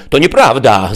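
high, loud protest; a child crying out in denial; cried out. A narrator's reading. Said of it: "zawołała Ewa". (głośny, wysoki okrzyk dziecka)

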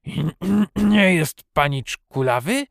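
put-on loud, croaking old man's question; hoarse, halting disbelief. A narrator's reading. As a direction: clear the throat and croak it out. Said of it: "skrzeczał dalej ochryple stary".

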